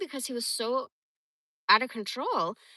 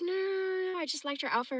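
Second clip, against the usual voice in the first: high, backpedalling denial; imitating defensive husband; 9 semitones higher, faster than usual. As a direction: imitate a husband hastily explaining himself, pitched up. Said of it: "And he'd be like".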